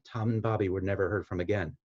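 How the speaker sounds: ordinary delivery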